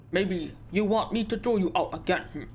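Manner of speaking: put-on menacing Russian-accented threat; a mild hammed-up Russian heavy; Russian sailor. Sailor threatening the stowaway in a Russian accent. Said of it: "how do you sound Russian?".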